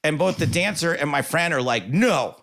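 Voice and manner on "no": imitating indignant friends, pitched high; insistent objection. Dancer and friend stop him apologizing, emphatically insistent. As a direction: forbid it firmly, as a protective friend would.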